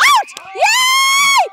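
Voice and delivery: high, loud excited yells; excited shouting. Real, not acted. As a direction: shout with delight at the wicket. Batter gets out, shouting.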